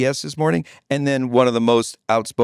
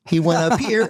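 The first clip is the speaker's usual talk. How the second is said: slightly raised voice demonstration; high voice; 3 semitones higher than usual. Shown as a demonstration. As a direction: lift the pitch to show a high voice. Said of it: "had a high voice and a low one".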